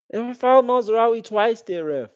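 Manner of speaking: high, loud furious shout; yelling at the ref. Real, not acted. Fan at the TV yelling at the referee, furious. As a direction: scream in outrage at the ref.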